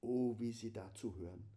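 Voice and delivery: ordinary delivery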